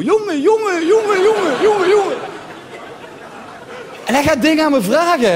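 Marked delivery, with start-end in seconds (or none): 0.0-2.2 high sputtering street-slang "jongen" chain; Moroccan Dutch street. Retold or imitated. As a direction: sputter like a car that won't start. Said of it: "net zo Daihatsu ... wil starten".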